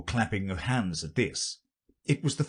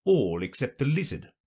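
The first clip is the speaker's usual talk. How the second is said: ordinary delivery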